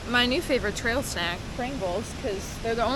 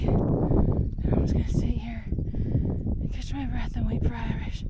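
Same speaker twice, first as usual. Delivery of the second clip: ordinary talk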